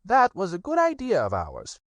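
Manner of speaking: high, pleased rabbit voice; high self-satisfied Rabbit. Acted. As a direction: Rabbit taking credit, high. Rabbit continues.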